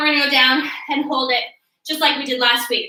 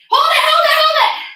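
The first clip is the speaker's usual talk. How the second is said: high, loud call to hold the pose; coach shout; 12 semitones higher, 5 dB louder than usual. Real, not acted. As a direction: bark it across the room to keep them holding.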